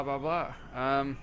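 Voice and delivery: ordinary delivery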